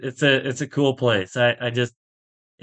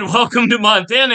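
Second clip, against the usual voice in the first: cheery welcome; 10 semitones higher, 4 dB louder than usual. Retold or imitated. Greeting Faldo.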